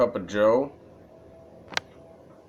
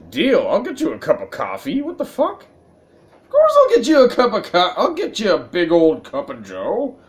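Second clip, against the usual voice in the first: mocking squeaky voice; 9 semitones higher, 6 dB louder than usual. Mocking the coffee vendor's offer.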